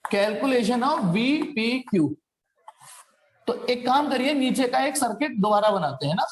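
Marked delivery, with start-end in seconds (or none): 0.1-2.1 very slow, spaced-out heading; slow dictation. Real, not acted. Teacher heading the VPQ calculation, slow dictation. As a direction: spell it out at writing speed. Repeats heading while writing it.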